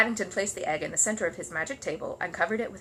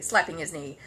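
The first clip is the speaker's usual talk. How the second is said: plain reading voice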